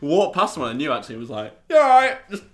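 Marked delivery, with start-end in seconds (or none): 1.7-2.2 high, loud, squeezed greeting; strained greeting. Retold or imitated. Man being choked out greets an acquaintance, strained and high. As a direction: call it out high mid-chokehold.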